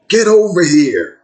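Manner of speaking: loud, high barked command; yelled order. Retold or imitated. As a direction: call him back hard. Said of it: "the feds yells out".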